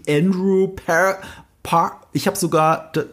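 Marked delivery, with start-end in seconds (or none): 0.1-1.2 "Andrew Par-" in American English; American pronunciation. Retold or imitated. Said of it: "die Amerikaner schwanken zwischen". ("Andrew Par-" amerikanisch ausgesprochen)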